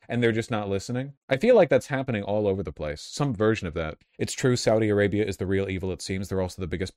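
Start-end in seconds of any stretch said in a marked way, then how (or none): none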